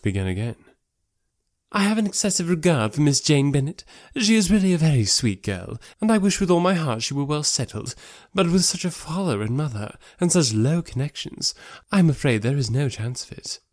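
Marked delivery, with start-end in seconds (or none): 1.7-13.6 higher-pitched society lady; regretful verdict in a raised, ladylike character voice; Missus Hurst. Acted. Sister discussing Jane Bennet's poor prospects, regretful.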